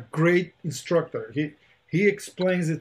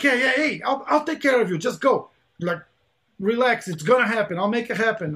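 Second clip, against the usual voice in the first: imitating a friend's higher-pitched, insistent reassurance; retold breezy reassurance; 6 semitones higher than usual. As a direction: wave the worry away, pitched up. Imitating friend brushing off worry.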